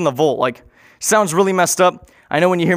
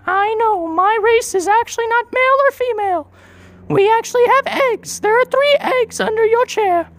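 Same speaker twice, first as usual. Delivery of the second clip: much higher alien voice; an alien explaining its eggs in a much higher voice. Retold or imitated. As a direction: speak as a calm alien, pitched far up.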